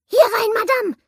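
high, loud shrill call; high shrill man's voice. Acted. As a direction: call out from the shadows. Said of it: "die hohe, schrille Stimme eines Mannes".

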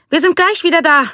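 very high shouted reassurance; calling back. A narrator's reading. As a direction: shout back that you won't be long. Calling back while walking off.

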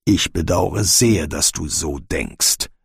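low, slow and formal general; stiff. A narrator's reading. General regrets the dwarf's distrust, stiffly. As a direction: say it slowly with stiff reserve, pitched low. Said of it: "sagte Bane steif".

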